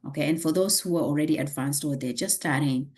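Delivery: usual speaking voice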